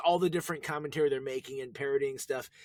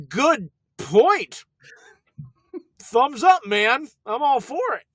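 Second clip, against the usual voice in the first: cheesy enthusiasm; 8 semitones higher, 6 dB louder, slower than usual. Acted. Jokey enthusiastic reply.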